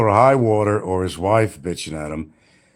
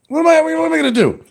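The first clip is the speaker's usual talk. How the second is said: exasperated high outburst; 4 dB louder, faster than usual. Real, not acted. Repeated in raised voice.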